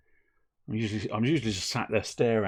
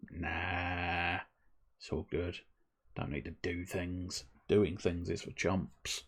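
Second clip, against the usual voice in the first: lazy inner voice; 4 semitones lower, 11 dB quieter, slower than usual. Pretend. Lazy inner voice tempting him.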